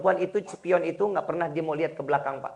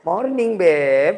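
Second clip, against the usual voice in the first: slow, drawn-out sweet morning greeting; a wife coos a slow good morning to her husband; flirty wife greeting; 7 dB louder, much slower than usual. Retold or imitated. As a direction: stretch it out lovingly to her husband.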